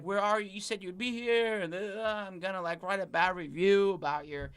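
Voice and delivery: high, frantic imitation of an angry client; mock panicked rant; freaking out. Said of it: "She is freaking out".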